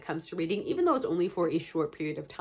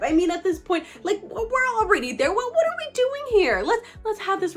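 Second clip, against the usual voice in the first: excited; 12 semitones higher, 6 dB louder than usual. Real, not acted. Playful plea to subscribe.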